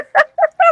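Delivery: sudden high laugh; high burst of laughter. Real, not acted. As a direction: squeal with laughter. Reacts to a joke.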